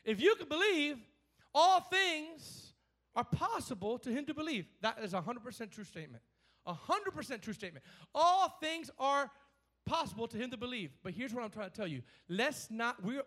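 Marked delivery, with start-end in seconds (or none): 0.0-4.7 loud, high stress on ALL things; emphatic proclamation. Acted. Preacher proclaiming Jesus' promise to the congregation, emphatic. Preacher reads scripture aloud. 8.1-9.3 all things are, drawn out slow and loud; slow emphasis. Shown as a demonstration. Preacher driving the verse home to listeners, slow and loud. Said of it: "repeating the verse for emphasis".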